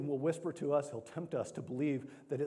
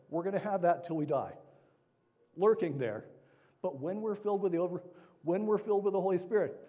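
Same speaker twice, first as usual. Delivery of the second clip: rising, louder sermon delivery; preaching; 4 semitones higher, 4 dB louder than usual. Acted. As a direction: preach it with conviction. Preaching with force.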